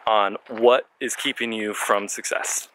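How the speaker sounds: ordinary talk, nothing marked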